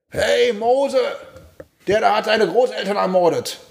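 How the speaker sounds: put-on high kid voice tattling to a friend; schoolboy calling out. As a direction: shout it across the shop like a ten-year-old. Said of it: "So, Mitschüler".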